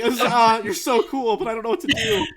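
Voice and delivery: high, loud, laughing fan gush; starstruck. Acted. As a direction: gush at your hero, laughing. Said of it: "I feel like".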